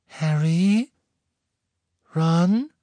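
put-on high, rising girlish calls; light, questioning voice; tentative calling. A narrator's reading. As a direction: feel for friends in the dark. Girl calls tentatively into the dark.

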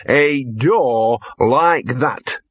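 "a door like that" very slowly stretched out; ponderous repeat. Acted. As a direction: draw out each word with weight. Dog repeats phrase very slowly.

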